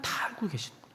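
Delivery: whispered in hushed awe; awed whisper. Real, not acted. Preacher realizing God sees everything, whispered in awe. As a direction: breathe it out as a stunned realization.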